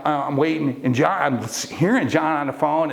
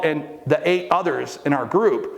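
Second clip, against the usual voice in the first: ordinary talk, nothing marked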